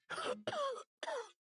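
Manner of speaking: a pained, rasping cough; weak cough. Acted. The dying knight breaks off his farewell, weak pained cough. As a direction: cough as if the body is failing.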